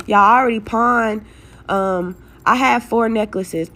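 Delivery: ordinary talk, nothing marked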